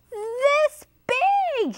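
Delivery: very high-pitched storybook exclamation; high, stretched mouse cry. A narrator's reading. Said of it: "he cried".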